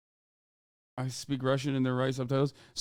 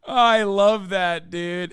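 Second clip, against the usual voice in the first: louder, slow, savoring every word; relishing delight; 3 semitones higher, 6 dB louder, much slower than usual. Real, not acted. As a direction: drag it out with loud glee. Savoring the clip after laughing.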